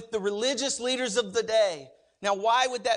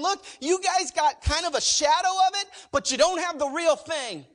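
emphatic preaching; 5 semitones higher, 4 dB louder than usual. Retold or imitated. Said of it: "what he was doing is saying".

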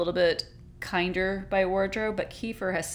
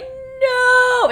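big high overdramatic "No!" yelled much louder; mock scream; 10 dB louder than usual. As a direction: ham up a screaming no. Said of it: "He's screaming".

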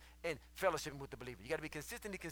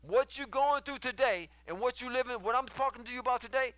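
loud, high sermon delivery; full-force preaching; 9 semitones higher, 10 dB louder than usual. Acted. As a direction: drive it home to the congregation like a preacher.

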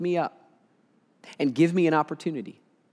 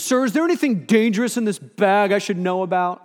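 a hint of a stern guard, higher; officer questioning; 4 semitones higher than usual. Guard asks the speaker about danger, lightly stern. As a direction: press him like a suspicious guard.

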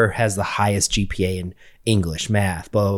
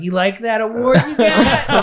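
much higher, slow, teasing through laughter; laughing gym teacher; 11 semitones higher, slower than usual. Retold or imitated. Gym teacher teasing the student, laughing. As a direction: tease him while cracking up. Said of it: "he starts cracking up".

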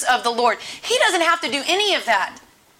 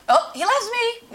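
mock hopeful; 8 semitones higher than usual.

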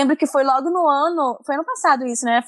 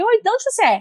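bright retold greeting; 9 semitones higher than usual. Retold friendly question at artists' tables.

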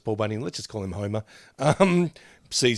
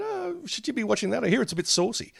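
soft, higher-pitched, lightly voiced old man; 11 semitones higher, 3 dB quieter than usual. Disapproving older man to partner's daughter about her show, mock old man voice. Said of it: "deliberately sort of putting on the old man voice".

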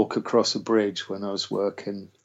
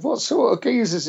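mock-alarmed squeak, much higher; alarmed high question; 13 semitones higher than usual. Asking the friend about the geezers, high and alarmed. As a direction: ask it in a high, startled voice.

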